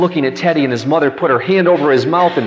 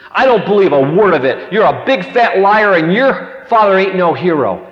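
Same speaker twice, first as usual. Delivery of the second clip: acted-out sassy girl, louder and higher; scornful challenge; 5 semitones higher, 4 dB louder than usual. New girl challenging Teddy's tale, mock scornful. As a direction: call his bluff like an indignant kid.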